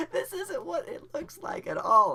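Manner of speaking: loud, high, tearful freak-out; mock panic. Nervous first-timer shocked by what he finds, high mock wail. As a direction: wail it in hammed-up dismay. I'm really scared at this point.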